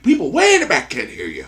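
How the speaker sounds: hammed-up demand to be heard; mock calling out. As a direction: play-act scolding from the back pews. Demanding the kid speak up.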